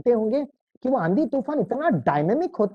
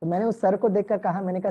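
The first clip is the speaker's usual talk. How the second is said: ordinary delivery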